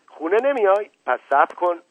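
high, loud threatening question; menacing challenge. Acted. Mother threatening her daughter, high angry challenge. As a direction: challenge her, ready to strike.

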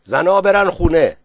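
high, loud shouted order. A narrator's reading. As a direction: shout it out across a crowd. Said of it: "با صدای بلند داد زد".

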